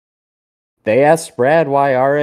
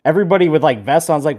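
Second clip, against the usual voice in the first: usual speaking voice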